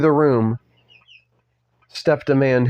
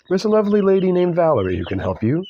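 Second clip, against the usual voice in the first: put-on higher-pitched, polite British accent; 5 semitones higher than usual. British lawyer directing Stubbs elsewhere, polite put-on accent. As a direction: offer help in an amateur British lilt. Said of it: "said the British man".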